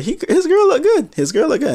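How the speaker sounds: big high squeal of admiration; be openly wowed by the photo; impressed. Real, not acted.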